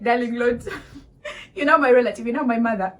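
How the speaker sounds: retold prayer dissolving into laughter; laughing prayer. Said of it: "I was like".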